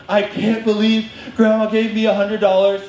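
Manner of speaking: high, thrilled disbelief; re-enacted childish excitement; excited kid. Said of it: "I was so excited".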